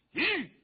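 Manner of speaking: loud, high whoa; high, forceful horse-halting cry; rider's whoa to a horse. Acted. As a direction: rein in a galloping horse with a sharp call. Rider reins in his horse.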